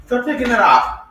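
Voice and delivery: loud laughing scold at the dog; half-laughing threat to a naughty pet; mock scolding. Real, not acted. Owner scolding her dog for peeing on couches, loud laughing.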